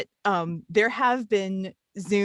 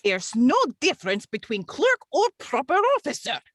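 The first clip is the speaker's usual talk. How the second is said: lightly put-on Russian accent as the clerk; a deliberately bad Russian accent, only hinted at; 7 semitones higher, 3 dB louder than usual. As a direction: clerk addressing the chairman in a faint mock Russian accent. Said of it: "bad Russian accents".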